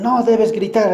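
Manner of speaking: mock shout. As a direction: yell it like a scolding parent. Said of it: "así le gritan con fuerza".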